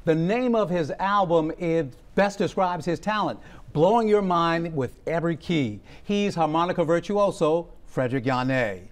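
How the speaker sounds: TV host intro. Acted. TV host welcoming a harmonica player onto the show, bright announcer read. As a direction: sell the guest to the audience like a show host.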